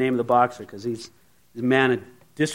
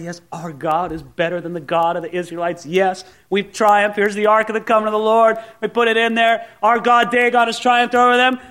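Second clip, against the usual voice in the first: higher and louder, gloating cheer; excited priests; 5 semitones higher, 3 dB louder than usual. Retold or imitated. Priests crowing over the Ark, excited and gloating. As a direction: crow over the captured Ark like a triumphant priest.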